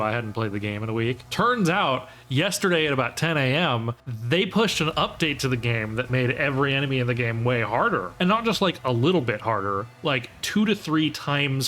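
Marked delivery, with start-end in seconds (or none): none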